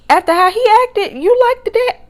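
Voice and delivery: high, loud disbelieving question; incredulous. Retold or imitated. Reacting in disbelief to his claim.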